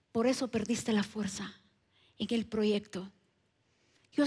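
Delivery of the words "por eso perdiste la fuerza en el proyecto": suave y grave, como voz interior; voz de Dios al corazón. Retold or imitated. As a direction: leve cambio a una voz divina más baja. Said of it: "me habla a mi corazón y me dice". (voz de Dios, baja y contenida)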